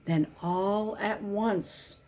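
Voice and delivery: ordinary delivery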